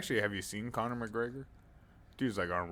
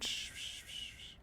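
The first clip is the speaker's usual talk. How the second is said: whispered shh; shushing; 14 dB quieter than usual. Pretend. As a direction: hush him urgently. Hushing the anime kid.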